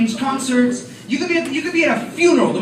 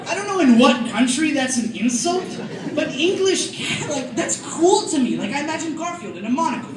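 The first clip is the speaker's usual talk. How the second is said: ordinary talk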